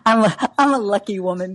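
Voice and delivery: high laugh flowing into words; laugh, then answer, still amused. Real, not acted. Laughing at the lucky-man remark.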